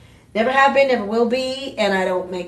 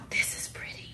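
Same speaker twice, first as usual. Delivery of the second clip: breathless whisper; 13 dB quieter than usual. Real, not acted. Gasping at the moissanite.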